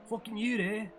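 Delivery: put-on high-pitched, botched Australian accent; attempted Australian accent. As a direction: another amateur stab at an Australian accent. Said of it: "I can't do it".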